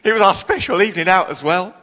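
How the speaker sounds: mock-pitiful, lightly played; put-on sad. Preacher telling the lady about the bad seats, put-on sad. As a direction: play up a touch of self-pity. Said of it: "put on my sad face".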